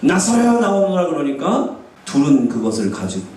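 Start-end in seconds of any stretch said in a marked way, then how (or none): 0.0-1.1 raised, summoning call; Jesus's command. Acted. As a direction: call the dead man out. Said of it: "예수님이 가셔가지고".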